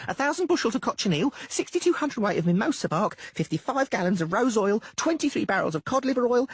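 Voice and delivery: high halfling rattling off a list; quick listing. Acted. Doppler proudly listing his purchases to Dainty. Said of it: "enumerated quickly".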